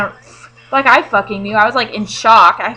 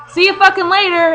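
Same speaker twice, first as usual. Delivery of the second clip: high mock farewell to the body; mock see-ya as the body bails; body's goodbye; 9 semitones higher, 4 dB louder than usual. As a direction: toss off a cheeky goodbye, pitched up. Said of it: "my body was like".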